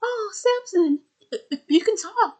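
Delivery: high, stuttered astonishment; high startled stammer; stammering amazement. Retold or imitated. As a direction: gasp it out, stunned. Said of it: "Kelson's like".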